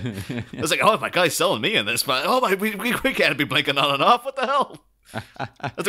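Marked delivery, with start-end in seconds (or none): 0.8-4.8 loud, high and flustered mock outburst; hammed-up alarmed mayor voice; panicked mayor. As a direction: blurt it out like a rattled official.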